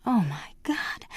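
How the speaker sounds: slow, breathless whisper; hushed aghast whisper; whispered thought. Acted. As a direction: whisper it to herself in dismay. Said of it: "holding the tiny bundle and thinking".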